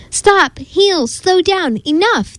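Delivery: high-pitched, separate cries; storybook crowd shouting after a dog. A narrator's reading. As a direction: call out commands without full volume. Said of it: "they shouted".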